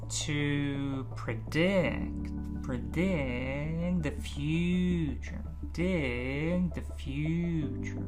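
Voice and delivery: very slow, soft, vowel to vowel; slow linking drill. Shown as a demonstration. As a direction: stretch it slowly and link the sounds. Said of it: "feel how we go from the vowel".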